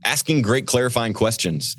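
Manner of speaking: usual speaking voice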